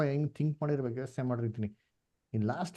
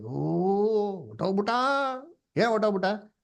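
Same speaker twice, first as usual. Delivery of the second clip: higher, hammed-up lament for the dead; mock worldly wailing; 6 semitones higher than usual. Teacher imitating worldly mourners at a death, mock wail. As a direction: play a mourner crying out, half in jest. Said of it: "ಲೋಕದಲ್ಲಿ ಅಳ್ತಾರೆ".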